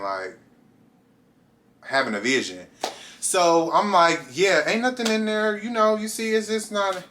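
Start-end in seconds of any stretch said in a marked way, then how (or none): 4.3-7.0 high, casual cover-up; mock innocent. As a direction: brush it off lightly in a much higher voice. Playing innocent as guard searches bag.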